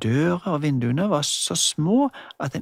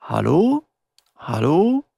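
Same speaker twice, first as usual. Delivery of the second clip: a light pretend mum, louder on the phone; playing the mother; 3 semitones higher, 5 dB louder, slower than usual. Julie voices the mother calling the doctor, lightly played. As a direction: call hello into a toy phone. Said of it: "Det var Julie som snakket for mammen".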